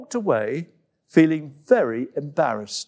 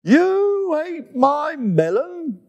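high, slow, outraged cry; monkey outraged her gift was eaten; shouted; 13 semitones higher, slower than usual. Acted. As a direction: cry out in disbelief. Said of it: "shouted Coco".

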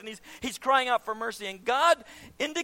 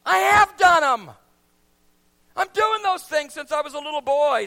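loud, higher indignant claim; young ruler's boast; 4 semitones higher, 8 dB louder than usual. Acted. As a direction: insist you've done it all. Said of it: "and the young ruler says".

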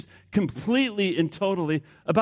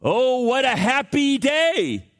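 high, drawn-out exultation; happy; 9 semitones higher, 3 dB louder, much slower than usual. Acted. Preacher voicing an unbothered John the Baptist, happy. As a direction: imitate someone overjoyed, stretching the words high. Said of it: "He says, "Hey, I'm happy".

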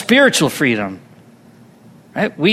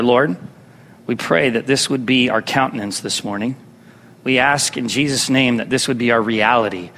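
prayer; 9 semitones lower, 5 dB quieter than usual. Real, not acted.

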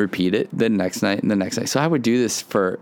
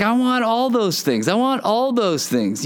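high-pitched, eager retold wanting; excited; 11 semitones higher than usual. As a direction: relive your eagerness, pitched way up. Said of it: "really excited".

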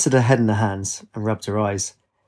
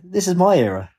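put-on Italian accent; 7 semitones higher than usual. A narrator's reading. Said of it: "With his strong Italian accent".

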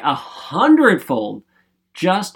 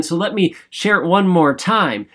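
usual speaking voice

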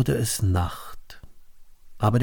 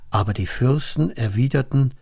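ordinary delivery, nothing marked

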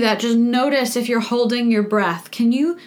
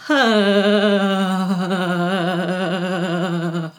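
long, shaky voiced sigh, lower; 3 semitones lower than usual. Shown as a demonstration. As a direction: let the shake out on a voiced sigh. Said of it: "let's let the shake out on a voiced sigh".

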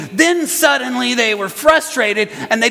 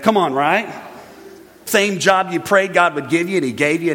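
normal talking voice

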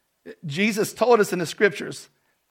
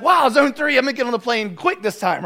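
excited; 4 semitones higher, 6 dB louder than usual. Retold or imitated. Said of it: "I was so excited".

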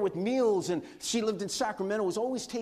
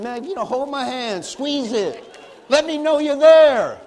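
higher and much louder, slow, begging for a real handshake; mock pleading; 7 semitones higher, 13 dB louder than usual. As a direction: beg for a firm grip, higher and louder, drawn out. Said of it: "I mean".

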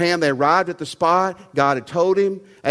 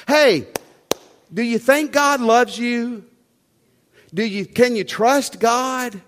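raised, loud preacher's appeal; full-force preaching; 5 semitones higher, 4 dB louder than usual. Acted. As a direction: preach it out, lifting the crowd up. Preacher urging the congregation.

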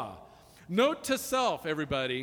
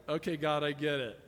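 humble prayer; 4 semitones lower, 3 dB quieter than usual. Shown as a demonstration. Humble admission to God.

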